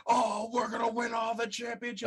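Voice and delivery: mocking stoked teammates on video; mock stoked players. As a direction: ham up the players' glee. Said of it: "They're so stoked".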